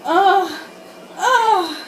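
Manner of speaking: giggling fake moan; mock moaning. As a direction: moan it over the top while cracking up. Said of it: "I began moaning uncontrollably".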